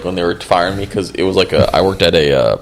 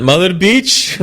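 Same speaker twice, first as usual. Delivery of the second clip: put-on Indian accent, rising on bitch; 9 semitones higher, slower than usual. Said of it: "I have to do it in the Indian accent".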